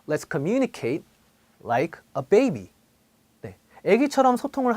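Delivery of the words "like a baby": put-on like a baby with baby stretched long and stressed; drawn out baby. Shown as a demonstration. Teacher slows down to show the stressed syllable. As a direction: linger on baby. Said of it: "두 번째 또 예를 볼게요".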